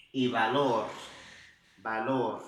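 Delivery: "valor" with the final r clearly tapped; final r voiced. Shown as a demonstration. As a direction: finish "valor" on a light tapped r. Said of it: "tá vendo que aqui eu puxei".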